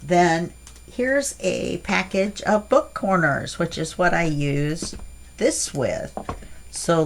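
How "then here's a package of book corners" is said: usual speaking voice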